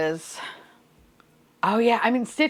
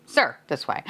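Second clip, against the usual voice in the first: usual speaking voice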